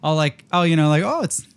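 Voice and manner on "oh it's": high, light Canadian "Oh, it's"; joking Canadian accent. Shown as a demonstration. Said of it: "put on this kind of an accent".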